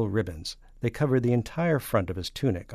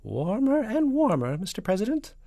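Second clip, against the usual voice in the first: falsetto; 14 semitones higher, 6 dB louder than usual. A narrator's reading. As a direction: coax the guesser in falsetto. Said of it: "and a falsetto voice".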